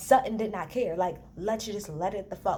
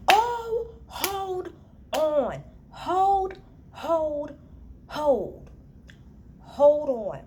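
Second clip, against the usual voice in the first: shocked stop; 8 semitones higher, much slower than usual. Real, not acted. Host halting the review over what Diana said, shocked high yelling. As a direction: stop everything in disbelief.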